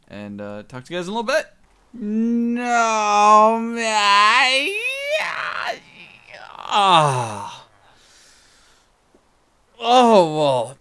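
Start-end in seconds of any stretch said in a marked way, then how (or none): 1.9-10.8 frustrated loud. Real, not acted. Plan goes wrong.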